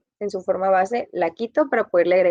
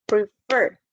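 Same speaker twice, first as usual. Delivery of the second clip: prefer with FER hit harder than pre; preFER, weight on the final syllable; stress on the second syllable. Shown as a demonstration. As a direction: say prefer in two beats, leaning on fer. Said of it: "Fer está más fuerte".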